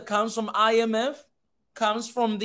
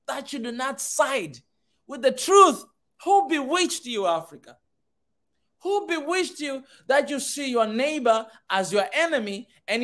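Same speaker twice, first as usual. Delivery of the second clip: Paul really mad; 4 semitones higher than usual. Retold or imitated. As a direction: thunder the apostle's angry question. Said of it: "And he was like".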